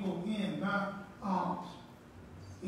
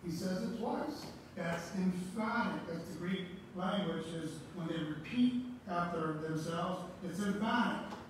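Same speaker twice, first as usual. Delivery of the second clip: raised, ringing pulpit voice; fervent preaching; 4 semitones higher, 3 dB louder than usual. Acted. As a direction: preach it out to the congregation, building. Preaching builds to full force.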